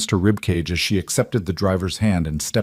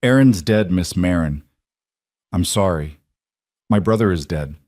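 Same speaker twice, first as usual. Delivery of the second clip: sorrowful. Acted. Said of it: "a sorrow so profound".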